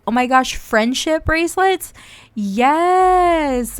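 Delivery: high and loud with glee; thrilled to find friendship bracelets; delighted find. Real, not acted. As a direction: squeal at the discovery.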